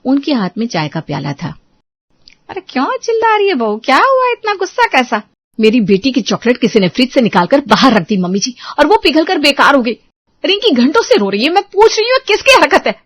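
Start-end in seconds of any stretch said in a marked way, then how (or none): 2.5-5.2 put-on high, puzzled older woman's voice; an elder asking what all the fuss is; mother-in-law questions the shouting daughter-in-law. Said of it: "उसकी सास सुनीता रसोई से बाहर". 5.6-12.9 rising from complaint to high loud demand; daughter-in-law complains to mother-in-law, heated; angry complaint. Acted.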